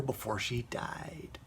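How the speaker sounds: a creepy, hushed whisper; spooky hushed aside; eerie whisper. Acted. Creepy plot twist in review.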